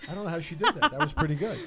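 usual speaking voice

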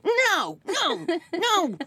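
high, anxious, rushed inner panic; panicked mom. Retold or imitated. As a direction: fret like a mortified mother. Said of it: "instead it's like".

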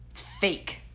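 breathy whisper, no voice. Real, not acted. To the viewer about fake happiness, whispered. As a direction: hush it out like a secret.

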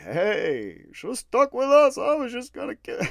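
high, loud goofy taunting voice; mock taunt. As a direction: taunt the dead man in a silly put-on voice. Voicing himself to dead Woody.

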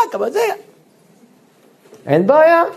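usual speaking voice

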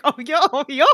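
imitated goofy hooting laugh. Co-host acting out Goofy finding it funny, goofy chortle. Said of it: "Goofy's laughing 'cause he thinks it's really funny".

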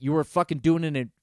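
ordinary talk, nothing marked

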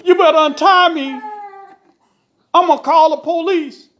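high, loud desperate warning; loud threat. A narrator's reading. Tied husband threatens police, loud warning. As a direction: warn her at the top of the voice.

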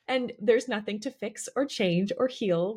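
ordinary talk

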